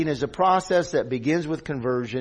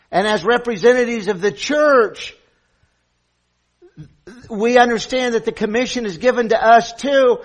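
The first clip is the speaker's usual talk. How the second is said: raised, louder pulpit voice; forceful preaching; 6 semitones higher, 7 dB louder than usual. Real, not acted. Preacher tells the church of its commission, preaching forcefully. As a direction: drive the point home to the congregation.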